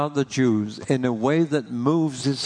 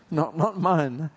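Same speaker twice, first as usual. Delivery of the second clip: ordinary talk, nothing marked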